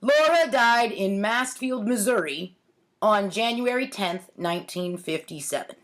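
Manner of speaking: ordinary talk, nothing marked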